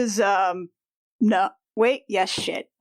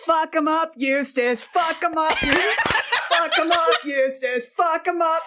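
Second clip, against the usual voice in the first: cheering on; 6 semitones higher than usual. Real, not acted. Cheering on a fighter.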